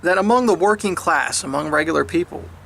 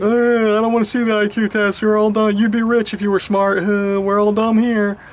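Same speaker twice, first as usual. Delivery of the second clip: mocking stubborn loser. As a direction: wave off the test like a know-nothing. Mocked reply to IQ test.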